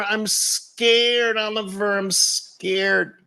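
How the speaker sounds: high-pitched, whiny put-on panic; mock scared follower. Scared follower pleading with Oliver about Bitcoin, mock-frightened.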